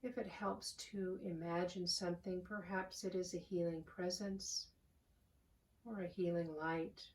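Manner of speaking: calm, unhurried voice with long pauses; soothing prayer-meditation delivery, phrase by phrase; guided meditation. Real, not acted. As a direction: guide them gently into the breath, softening as you go. Leads a guided breathing meditation.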